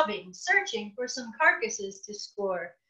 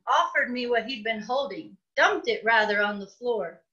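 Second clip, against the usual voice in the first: ordinary delivery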